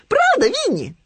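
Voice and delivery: very high, loud little piglet voice; Хрюня. A narrator's reading. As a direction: squeal it out like a small piglet. Поросёнок Хрюня ждёт подтверждения. (тонкий громкий голос поросёнка)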